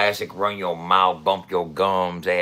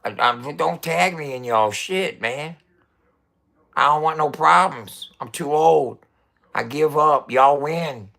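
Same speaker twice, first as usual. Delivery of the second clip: mock surrender; 5 semitones higher, 4 dB louder than usual. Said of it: "I'll be like".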